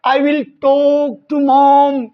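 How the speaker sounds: very slow, spaced words; slow model line. Shown as a demonstration. Teacher voices the husband's promise slowly. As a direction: say it slowly for learners. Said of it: "का मतलब it's okay".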